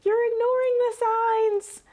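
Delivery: mock frustrated shout-out; exasperated viewer. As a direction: yell at the team through the TV. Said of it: "we are sitting here going".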